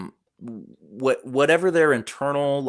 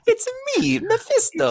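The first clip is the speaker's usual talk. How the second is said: much higher squeaky mock reveal; mock villain reveal. Friends spoofing the devil reveal, as a squeaky mock villain. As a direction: announce yourself like a silly cartoon villain.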